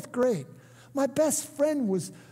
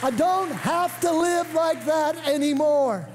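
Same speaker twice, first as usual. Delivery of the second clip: slow, raised preacher's delivery; high-energy praise from the pulpit; rousing proclamation; 3 semitones higher, slower than usual. Real, not acted. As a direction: declare it in triumph, stretching each phrase. Preacher rouses cheering congregation.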